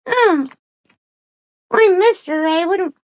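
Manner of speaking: very high, squeaky dog voice; talking dog. Acted. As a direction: speak as the dog in a tiny high-pitched voice. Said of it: "And he said".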